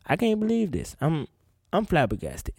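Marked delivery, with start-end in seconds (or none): none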